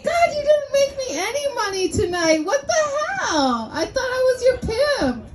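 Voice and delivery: high, whiny hammed-up complaining; mock upset pimp. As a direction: pretend to be a hurt pimp, half laughing.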